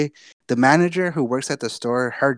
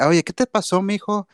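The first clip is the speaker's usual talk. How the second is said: higher, motherly Spanish concern; worried McDonald's lady; 8 semitones higher than usual. Retold or imitated. McDonald's lady asks what happened, higher and concerned. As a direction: call out to him like a worried auntie.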